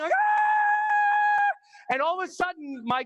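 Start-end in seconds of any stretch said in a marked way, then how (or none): 0.1-1.9 very high, sustained mock scream; falling scream. Skydiver thrown out of the plane, long high scream. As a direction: let out a long piercing acted scream. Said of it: "And so I'm falling".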